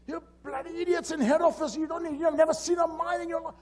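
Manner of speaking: high-pitched screaming rant; retold yelling. Mine boss raging at head office and the newcomer, yelling. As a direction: rage at them with the voice way up high. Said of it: "And he yells".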